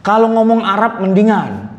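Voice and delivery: ordinary delivery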